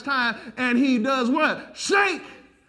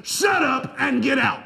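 shouted rebuke; 3 semitones higher than usual. Acted. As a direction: hurl the command out slowly. Preacher's climactic shouted rebuke.

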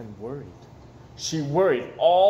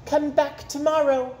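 higher, cheerful call; teacher calling out; 10 semitones higher, 3 dB louder than usual. Acted. The teacher calls to students leaving, high and bright. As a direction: call after the kids at the door. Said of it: "called Missus Peachum".

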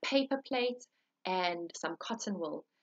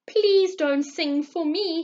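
pleading bird; 6 semitones higher, 8 dB louder, slower than usual. Acted. Thrush refusing politely.

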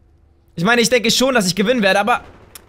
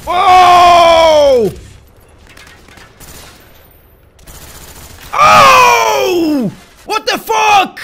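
very loud, much higher cries of disbelief; shocked yells; 8 semitones higher, 7 dB louder, much slower than usual. Real, not acted. Gamer stunned by a sudden kill, shocked shouting. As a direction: scream your shock at the screen. Shocked reaction to game event.

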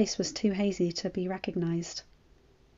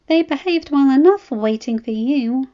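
higher, louder pleading whine; complaining to her husband in a higher, louder whine; whining wife; 6 semitones higher, 8 dB louder than usual. A narrator's reading. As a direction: whine it like a tired pregnant wife. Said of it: "Danny whined".

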